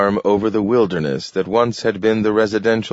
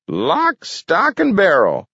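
put-on high triumphant old man; 14 semitones higher, 4 dB louder, slower than usual. A narrator's reading. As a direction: crow each word in victory. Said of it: "said Gramp triumphantly".

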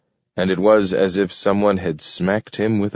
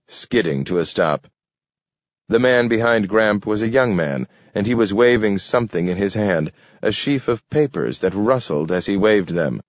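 ordinary delivery

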